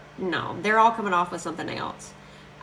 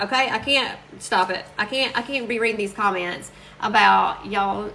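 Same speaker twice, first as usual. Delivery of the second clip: ordinary talk, nothing marked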